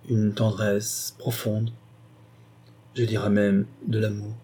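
lent, chaque mot bien posé; détacher lentement une tendresse profonde; mots détachés. Acted. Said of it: "Elle recommença à détacher ses mots". (Clémence au marquis, mots lentement détachés)

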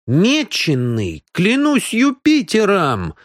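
exclaiming. A narrator's reading. Сандал finds the card is marked, exclaiming. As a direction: draw it out, climbing into an oath. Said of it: "воскликнул он".

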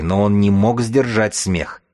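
usual speaking voice

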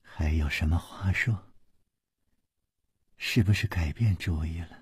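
low, quiet challenge. A narrator's reading. Shi Guanyin glaring at Chu Liuxiang, low quiet challenge. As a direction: question him in a low hushed voice.

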